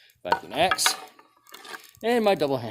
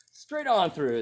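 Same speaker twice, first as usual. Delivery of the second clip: pitched up high, amused at the easy cut; delighted exclaim; 9 semitones higher, slower than usual. Real, not acted. Tester watching axe slice the pan, delighted.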